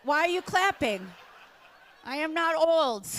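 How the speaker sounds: normal talking voice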